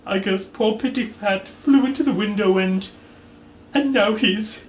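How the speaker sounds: high, tearful breaking voice. Acted. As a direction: choke up mid-sentence. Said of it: "Mister Body let out a sob".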